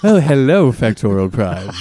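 low, luring seductive voice; sexy voice. Acted. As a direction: purr it low and sultry. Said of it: "luring, sexy voice guy".

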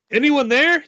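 high, thin, tentative call; small high voice into darkness. A narrator's reading. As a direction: call out thinly, pitched well up. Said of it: "you ask in a thin voice".

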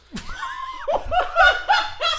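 loud, high laughing shriek; loud burst of laughter. Real, not acted. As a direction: explode into laughter.

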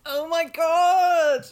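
loud, drawn-out gasp of shock. Real, not acted. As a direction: stretch it out in disbelief. Shocked reaction to plot twist.

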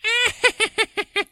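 high far-off cackle, retold from the film; imitated witch cackle. Retelling the witch's last moment: high mock cackle. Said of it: "And they just hear".

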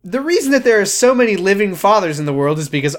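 high, loud sarcastic announcement; mock grand pronouncement. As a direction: proclaim it like obvious truth.

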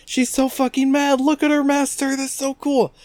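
squealing high fangirl gush; high gushing fan voice. Retold or imitated.

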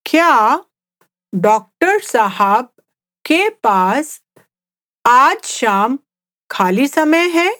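slow Hindi question in short chunks, rising at the end. Shown as a demonstration. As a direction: pause after each phrase for learners. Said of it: "let's just speak at a slower pace".

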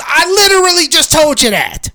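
high, loud exasperated mock yell; imitated shouting. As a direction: mimic a wife yelling back at her husband. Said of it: "they start shouting at each other".

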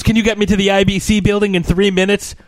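high excited ask; quoting her. Retold or imitated. Retelling a movie line, high-pitched quote. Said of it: "And she goes".

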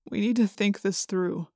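soft, tired suggestion; weary. A narrator's reading. Jake to the group after defeat, weary. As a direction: say it wearily, energy spent. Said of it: "Jake said wearily".

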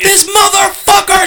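high, strained shout of outrage; yelling in anger. Retold or imitated. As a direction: re-enact an angry yell down the phone. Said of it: "Why are you yelling at me".

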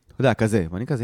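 ordinary talk

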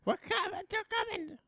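very high, strained put-on voice; Carl impression. Imitating Carl's warning for the co-host, silly high accent. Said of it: "That's my Carl accent".

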